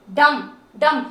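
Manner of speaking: "डम" said short with an "a" vowel, b silent; model pronunciation. Shown as a demonstration. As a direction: say "डम, डम" clearly, no b at the end. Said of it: "यू को अ का sound देना है".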